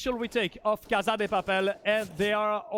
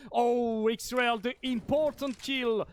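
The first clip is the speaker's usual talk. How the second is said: ordinary talk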